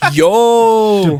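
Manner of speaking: high, loud laughing yo; excited whoop. Real, not acted. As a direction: cheer it like great news. Excited reaction.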